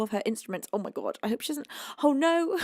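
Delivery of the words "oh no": usual speaking voice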